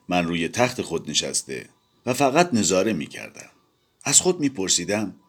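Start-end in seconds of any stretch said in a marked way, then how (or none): none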